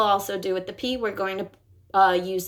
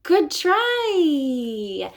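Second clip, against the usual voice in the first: higher, cheery "Good try"; encouraging; 6 semitones higher than usual. Shown as a demonstration. Therapist encouraging a child after a miss. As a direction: lift the voice to encourage.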